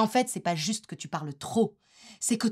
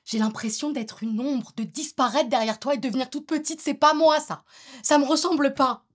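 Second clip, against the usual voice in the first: higher, building to a loud outburst; mounting exasperation, pitched up; upset protest; 5 semitones higher, 6 dB louder than usual. Acted. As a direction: let the hurt swell into a raised voice. Partner protests at feeling erased.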